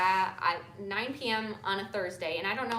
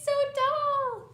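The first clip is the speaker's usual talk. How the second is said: amazed; 5 dB louder, slower than usual. Real, not acted. Marvelling at daughter's height.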